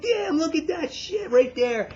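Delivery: high, wide-eyed disbelief; stunned excitement. Retold or imitated. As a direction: gawk at the screen in amazement. Viewer staring at the gore.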